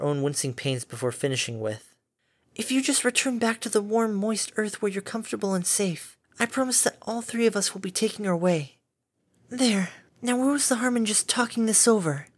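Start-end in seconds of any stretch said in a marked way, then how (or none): 2.6-12.3 calm a frightened beast kindly; gentle caretaker Fluttershy. A narrator's reading.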